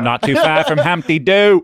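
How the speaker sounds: slow, drawn-out Aussie phrase; mock Australian, slowly stretched. Podcaster riffing on Australian towns, slow mock Australian accent. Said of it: "That was a little J F K".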